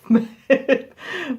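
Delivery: high, loud burst of laughter; embarrassed laugh. Real, not acted. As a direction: laugh at your own bad French. Laughs at own French attempt.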